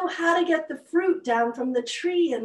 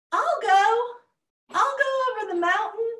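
high, loud little voice; squeaky turtle volunteering; small voice; 9 semitones higher, 6 dB louder than usual. Acted. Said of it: "a very small voice spoke up".

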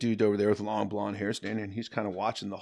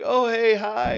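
mock polite crowd; 13 semitones higher, 8 dB louder, slower than usual. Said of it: "everybody's just like".

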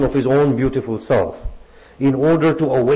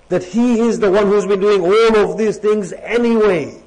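raised, fervent sermon voice, pitch climbing; preaching at full force; 3 semitones higher than usual. Acted. Preacher rousing the congregation about prayer, fervent and raised. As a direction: preach it with mounting fervor, slowing on key phrases.

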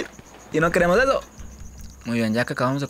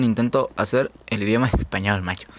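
normal talking voice

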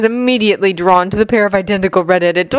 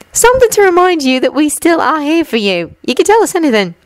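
put-on high-pitched girl character; light, high character voice; Ginny, teasing but supportive; 9 semitones higher than usual. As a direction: voice a friend gently insisting, pitched well up.

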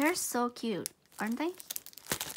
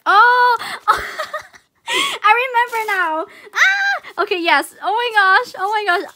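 high, loud shrieks with laughter; thrilled realization; 9 semitones higher, 12 dB louder, slower than usual. Real, not acted. Remembering she asked her friend for these, thrilled shriek. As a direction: shriek as the memory hits, overjoyed. Suddenly remembers requested gift.

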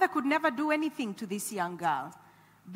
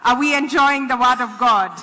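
laughing call to crowd; 9 dB louder than usual. Real, not acted. Rousing a quiet audience.

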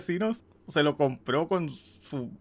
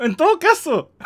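laughing; 9 semitones higher, 5 dB louder than usual. Real, not acted. Se ríe del personaje.

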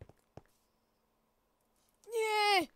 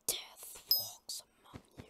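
ominous whisper. Pretend. Ominous boast after TNT joke.

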